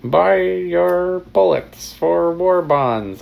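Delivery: put-on slow old-timey radio announcer; 1930s announcer. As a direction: announce it like a wartime newsreel. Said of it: "nineteen thirties announcer guy".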